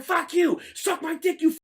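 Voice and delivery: high-pitched cursing retort; acted angry taunt. Other driver taunting him in traffic, high angry yell. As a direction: spit back insults, pitched high. Acted road-rage quarrel.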